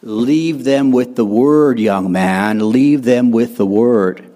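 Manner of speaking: put-on old preacher's slow, low, loud drawl; slow, weighty command to a young preacher, pitched low; J. Vernon McGee. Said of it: "he would say to us".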